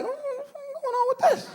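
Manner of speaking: a baffled high squeak; puzzled dad. Retold or imitated.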